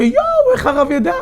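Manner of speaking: sudden high yelp of astonishment; shocked amazement. Retold or imitated. To the rabbi, a shocked, high-pitched "how did you know". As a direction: gasp it out, stunned. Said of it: "הייתי בהלם".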